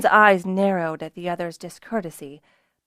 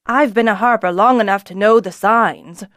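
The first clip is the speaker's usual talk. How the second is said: louder gruff rebuke; growled; 2 semitones higher, 8 dB louder than usual. A narrator's reading. Old harper rebukes Kelsa, louder growl. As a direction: growl it down at her. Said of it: "Zist growled at her".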